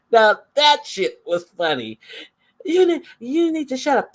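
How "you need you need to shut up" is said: high mocking impression of a bickering woman; hammed-up high-pitched mimicry; mocking quote. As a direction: squeak it out, mocking her.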